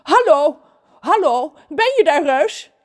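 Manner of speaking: high, loud chirpy voice; mermaid on the phone. Acted. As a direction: call down the line. Trudy aan de telefoon. (hoge zeemeerminstem)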